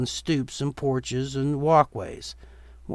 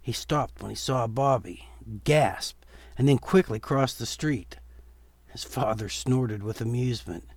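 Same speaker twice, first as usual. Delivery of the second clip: usual speaking voice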